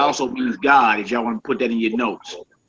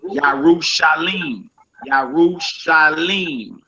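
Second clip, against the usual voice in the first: Yarushalim drawn out slowly, syllable by syllable; slow careful Hebrew; 3 semitones higher, slower than usual. Shown as a demonstration. Teacher drilling Yarushalim, slow and deliberate. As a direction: spell out Yarushalim slowly for the class.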